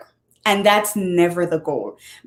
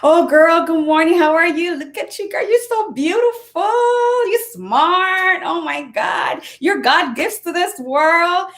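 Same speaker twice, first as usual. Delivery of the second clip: put-on much higher, bubbly "Oh, girl" cheering herself on; gushing pep talk to herself; 9 semitones higher than usual. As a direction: hype yourself up in the mirror like a best friend. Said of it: "I talk to myself. I'm like".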